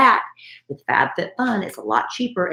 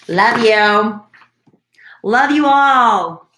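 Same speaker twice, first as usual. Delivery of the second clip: cheery sign-off; 5 semitones higher, 5 dB louder, slower than usual. Real, not acted. Sign-off to listeners.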